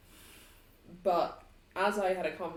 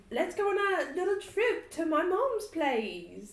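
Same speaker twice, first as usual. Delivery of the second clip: much higher, slow and sing-song; drawn-out singsong announcement, pitched way up; 11 semitones higher, 5 dB louder, slower than usual. Acted.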